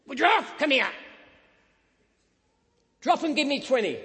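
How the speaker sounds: loud, high barked orders; drill sergeant. Retold or imitated. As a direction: bark it like a sergeant major. Sergeant major barking orders.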